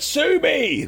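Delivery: high, cocky yell of sue me; defiant shout. Retold or imitated. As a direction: fling it back while driving off. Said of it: "And he's like".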